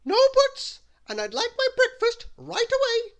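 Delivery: very high, loud bossy order; piercingly high, overbearing demand; Little Miss Bossy. A narrator's reading. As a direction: command them at full volume. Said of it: "ordered Little Miss Bossy".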